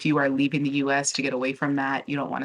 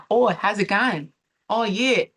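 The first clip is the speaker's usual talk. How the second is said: higher-pitched mock Australian accent; 6 semitones higher, 3 dB louder than usual. Vlogger imitating Australian men for her viewers, badly faked Australian accent. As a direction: put on a hammed-up Aussie accent, slipping towards British. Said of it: "don't wanna do the accent, but here we go".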